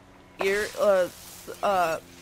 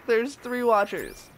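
usual speaking voice

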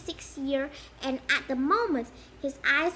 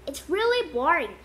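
ordinary delivery

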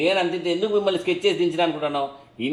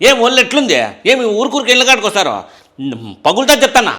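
loud, high, menacing yell; threatening shout; 5 semitones higher, 9 dB louder than usual. Acted. Tenant threatens the two landlords with a loud, high yell. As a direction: bark the threat right in their faces.

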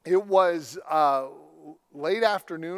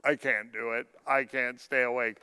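lower, drained voice of an exhausted driver; worn-out driver; 5 semitones lower than usual. Retold or imitated. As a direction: sound like you are fading at the wheel. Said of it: "and I was like".

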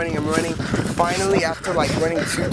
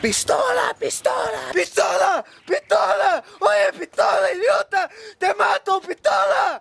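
high, shrieking yell of pistola over and over; desperate high-pitched shouting in broken Spanish; frantic screaming. Retold or imitated. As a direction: scream it at the thieves, voice cracking. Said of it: "That's all I kept screaming".

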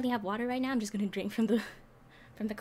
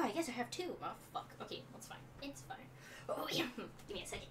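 muttered aside; 2 semitones lower, 14 dB quieter, faster than usual. Real, not acted. Muttering to herself.

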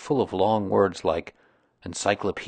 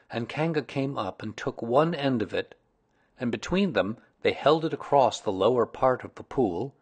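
ordinary delivery

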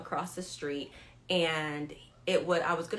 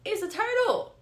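high, louder, duh-it's-obvious retort; deadpan obvious reply; 6 dB louder than usual. Retold or imitated. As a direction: state the obvious with mock exasperation. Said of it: "And I'm like".

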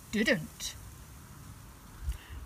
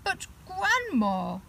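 scared little bear; 10 semitones higher, 3 dB louder, slower than usual. Acted. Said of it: "feeling very scared now".

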